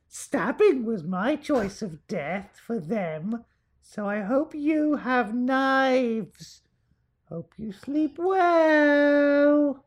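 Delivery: put-on high, slow, eerie little girl; sing-song creepy ghost child. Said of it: "Do it in a creepy girl voice".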